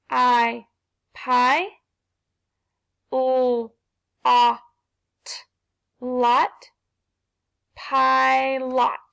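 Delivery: pilot with a long I and a full O, pie-lot; sounding out. Shown as a demonstration. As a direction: tap out pie, then lot, then blend into pilot. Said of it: "let's put 'em together".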